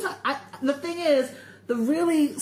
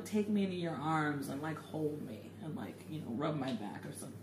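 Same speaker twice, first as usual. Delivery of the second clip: softly; 10 semitones lower, 14 dB quieter, faster than usual. Real, not acted. Describing being comforted.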